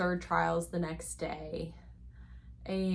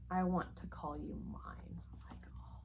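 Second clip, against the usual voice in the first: soft whispered romantic line; whispering; 11 semitones lower, 8 dB quieter than usual. Retold or imitated. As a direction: whisper it, then melt. Reading swoony line then swoon.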